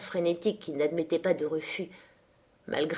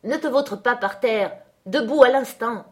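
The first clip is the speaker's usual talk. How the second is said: higher, forceful scolding order; scold, then call out the order louder; raised-voice angry command; shouted command; 5 semitones higher, 5 dB louder than usual. A narrator's reading. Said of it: "cria-t-il".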